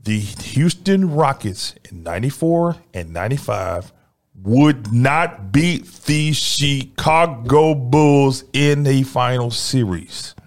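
very slow, word by word; slow, deliberate. Real, not acted. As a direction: spell it out slowly for the fans. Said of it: "let me say it real slowly so you can understand".

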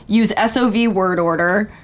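ordinary talk

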